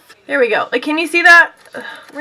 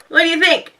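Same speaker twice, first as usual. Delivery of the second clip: ordinary delivery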